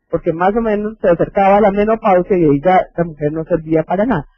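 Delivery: usual speaking voice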